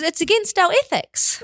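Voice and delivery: high, slow mum pronouncement; imitating mum. Mum objecting to McDonald's, high and slow imitated mum voice. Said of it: "she goes".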